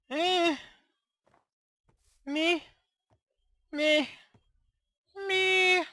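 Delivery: high, drawn-out doubtful meh; unimpressed meh. Real, not acted. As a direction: sound underwhelmed by the roof. Judging his roof, unimpressed.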